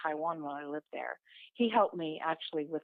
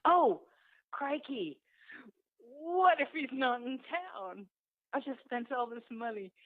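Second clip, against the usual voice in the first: higher, flustered worry over money spent; mild panic; 6 semitones higher, slower than usual. Retold or imitated. To herself after booking the trip, a touch of panic. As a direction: panic that the trip was wasted.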